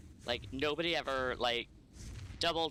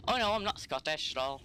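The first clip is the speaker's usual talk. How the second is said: put-on Scottish accent; 3 semitones higher, faster than usual. Telling the lunch couple he's not Scottish, in a lightly put-on Scottish accent. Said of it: "use a Scottish accent for the entire day".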